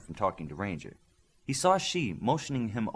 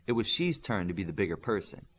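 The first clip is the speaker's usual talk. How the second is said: plain reading voice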